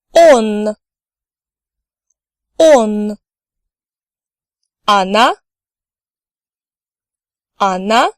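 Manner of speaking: each Russian pronoun said clearly, then again; teacher's clear drill voice; slow repeat-after-me drill. Shown as a demonstration. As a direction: model pronunciation for learners to repeat. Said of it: "I will read each personal pronoun twice".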